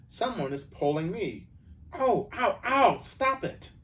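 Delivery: put-on a girl pulled by an unseen force; slowing into frightened ows; frightened cry. Susan being dragged by magic.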